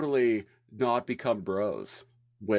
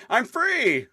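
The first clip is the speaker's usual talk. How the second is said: high excited shout of freedom; gleeful character yell; excited cry; 4 dB louder than usual. Retold or imitated. Quoting character in recap.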